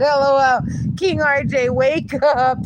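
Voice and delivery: loud teasing wake-up; loud wake-up call. Real, not acted. Loudly waking the sleeping dog.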